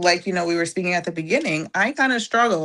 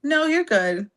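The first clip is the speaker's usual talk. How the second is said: daughter's reassurance; 8 semitones higher than usual. Retold or imitated. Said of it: "she was like".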